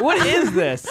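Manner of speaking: ordinary talk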